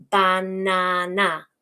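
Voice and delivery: "banana" slow, in three even beats; syllable segmenting. Shown as a demonstration. Teacher demonstrates syllables, "banana" split slowly and evenly. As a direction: break "banana" into ba, na, na. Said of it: "its syllables, like this".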